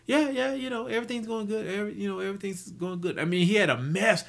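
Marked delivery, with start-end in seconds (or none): 0.1-3.2 much higher, airy imitation of him waving it off; mock-casual reassurance in his voice; breezy brush-off. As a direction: play it off lightly, pitched well up. Old colleague brushing off his troubles.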